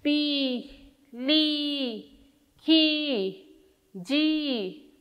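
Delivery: पी, ली, खी, जी each with a held long ई; teacher drilling long ई syllables. Shown as a demonstration. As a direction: count out the syllables, each vowel stretched. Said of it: "थोड़ा लंबा बोलना पड़ जाता है".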